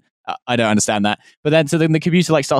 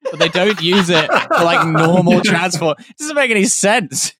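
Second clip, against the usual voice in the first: laughing; 6 semitones higher than usual. Real, not acted. Laughing through an absurd complaint.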